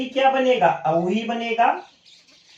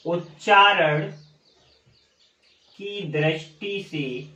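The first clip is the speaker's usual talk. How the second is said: slow dictation; 3 semitones lower, 3 dB quieter, slower than usual. Shown as a demonstration.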